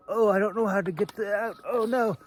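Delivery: high, loud, hammed-up fluster; mock panic. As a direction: play the helpless fumbler, all squeaky alarm. Mocking a clumsy sword drawer.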